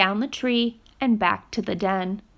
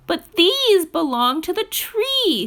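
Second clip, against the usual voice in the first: much higher eager little animal voice; Fletcher's voice; 8 semitones higher, 5 dB louder than usual. Acted. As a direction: promise it earnestly like a small child. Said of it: "said Fletcher".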